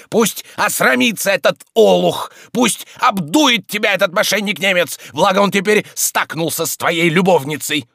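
high, loud spiteful ranting; furious yelling. Acted. Tarantyev reviles Oblomov and the German, furious yelling. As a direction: keep bawling curses at him. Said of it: "вопил Тарантьев".

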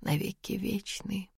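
very soft inner repeat; to herself. Acted. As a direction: say it to herself, barely voiced. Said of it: "повторяя про себя".

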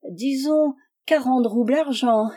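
piteous, coaxing bargainer; heart-melting plea. A narrator's reading. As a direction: beg as if to move stones to pity. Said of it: "d'une voix à attendrir les pierres".

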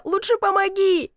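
high, urgent plea; pleading. A narrator's reading. Stuck man begging woman for help, pleading. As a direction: beg for help. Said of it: "взмолился я".

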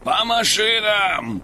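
громкая протяжная команда, выше и медленнее; проревите команду во весь голос; рёв капитана. Acted. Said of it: "Рёв капитана". (рёв, медленный и высокий)

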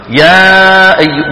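يا أي stretched slowly with the mouth opened far too wide; wrong way, mouth gaping too much; over-opened mouth. Shown as a demonstration. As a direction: exaggerate the open jaw on يا أي. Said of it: "مو تفتحه كمان فتح مبالغ".

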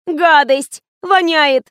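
high, loud whine of revulsion; whining disgust. A narrator's reading. Fatima whining to the director about smelly milk, high and loud. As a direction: wail each word in high disgust. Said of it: "ныла Фатима".

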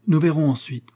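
stern father's rough demand; gruff father. A narrator's reading. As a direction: rebuke your son coldly. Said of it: "fit-il rudement".

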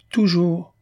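« Toujours » lancé haut et fort, bien appuyé. A narrator's reading. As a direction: marteler « Toujours » avec insistance. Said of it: "elle appuya". (elle insiste auprès de Christiane, « Toujours » haut et appuyé)

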